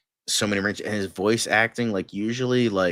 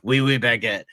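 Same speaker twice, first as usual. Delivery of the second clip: mock French accent; 3 semitones higher than usual. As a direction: play a pretentious Frenchman with a mild fake French accent. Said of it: "the most over-exaggerated French accent".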